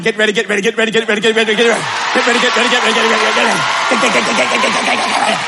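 lightly voiced chanting preacher; imitating a preacher. Mimicking a favourite preacher to a man, light chant. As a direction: rattle it off like a revving preacher. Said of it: "I like this one".

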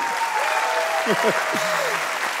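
quick unvoiced chuckle at the crowd; short airy laugh; breathy laugh. Real, not acted. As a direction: laugh off the weak response.

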